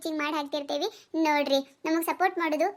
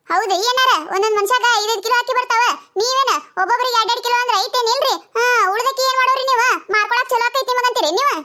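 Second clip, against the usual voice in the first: lightly played indignant ration customer, raised pitch; pitched-up quarrelling customer; 7 semitones higher, 10 dB louder than usual. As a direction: argue back at the shopkeeper.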